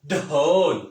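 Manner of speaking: ढोल with a heavy, loud, breathy ढ; heavy sound. Shown as a demonstration. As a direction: boom out ढोल heavily. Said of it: "ऐसे भारी आवाज़". (भारी आवाज़ में ढोल)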